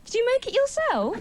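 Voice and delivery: high-pitched, patronising question; mock cooing. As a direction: ask as if praising a small child. Talking down to audience man.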